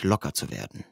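ordinary delivery, nothing marked

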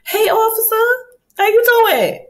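high, sugary flirt with the officer; flirty sweet voice. Retold or imitated. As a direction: bat your lashes through the words.

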